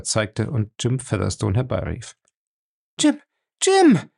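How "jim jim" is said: very high, loud cry of a name; frantic shout across the cellar; calling out urgently. A narrator's reading. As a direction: call him with all her strength. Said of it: "rief sie heftig".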